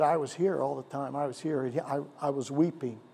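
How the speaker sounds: very soft, low and hushed; softly voicing a weeping Jesus. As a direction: let it fall to a tender near-whisper.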